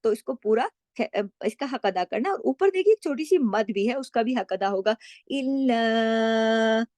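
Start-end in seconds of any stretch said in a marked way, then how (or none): 5.3-6.9 drawn-out chanted reading; slow Quranic recitation. Shown as a demonstration. Teacher recites the phrase for the class. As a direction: recite slowly, each syllable held.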